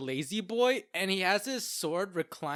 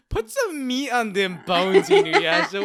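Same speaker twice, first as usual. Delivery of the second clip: mock scolding; 7 semitones higher, 10 dB louder than usual. Mock scolding Inuyasha, laughing.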